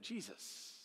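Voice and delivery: breathy whisper on "Jesus". Retold or imitated. Youth minister whispering the name to campers. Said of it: "And he'd say it like that".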